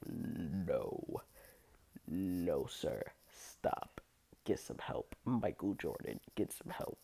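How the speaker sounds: low, soft, half-whispered pleading; hushed refusal. Retold or imitated. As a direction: back away, quietly telling him off. Telling the flasher to stop.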